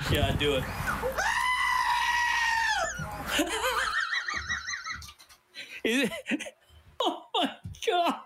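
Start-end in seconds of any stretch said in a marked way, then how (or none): 0.6-8.1 high shrieking laugh; helpless laughter. Real, not acted. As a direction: laugh until the words break. Laughing at replay.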